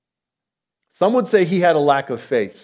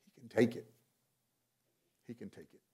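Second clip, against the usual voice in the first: reassuring; 2 semitones lower, 16 dB quieter, faster than usual. Real, not acted. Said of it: "reassuring aside".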